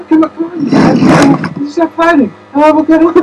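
high, slow, soft-spoken pleading; soft-spoken Wahlberg impression. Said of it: "so soft-spoken in that movie".

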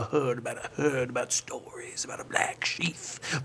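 hushed, secretive whisper. Acted. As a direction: breathe it like a dark secret.

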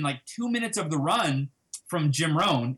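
normal talking voice

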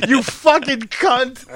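slow jeering insult. Retold or imitated. Driver behind jeering as he passes her, slow and drawn out. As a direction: drag it out with contempt.

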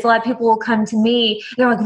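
ordinary talk, nothing marked

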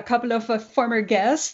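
ordinary delivery

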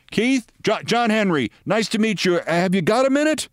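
high, bright-eyed owner voice pitched well up; eager friendly greeting. Retold or imitated. Team owner eagerly greets the reporter, pitched high. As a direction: owner stops the reporter in the dugout.

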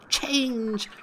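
high ringing cry of change; magic command. A narrator's reading.

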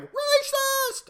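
shrill mocking shriek of outrage; high mocking screech. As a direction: shriek it like a hysterical accuser. Mocking a woke accuser.

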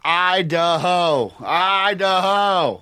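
high, very drawn-out, falling; longing exclamation. Real, not acted. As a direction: stretch the vowels out dreamily. Naming the dream place to move.

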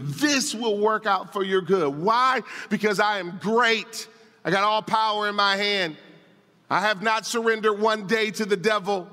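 impassioned preaching. Acted. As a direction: preach it as a firm promise. Preacher speaks as God.

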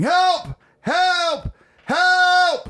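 very high, loud mock cries for help; fake screams for help. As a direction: scream for help as if in danger to scare dad. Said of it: "I started screaming".

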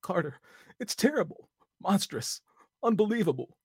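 high, loud frantic terror; frenzied. A narrator's reading. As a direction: cry out in horror. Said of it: "Then came the frenzied tone again".

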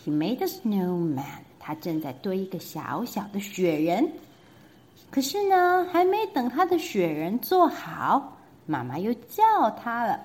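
plain reading voice

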